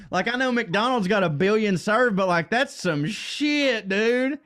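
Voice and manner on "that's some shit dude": ordinary talk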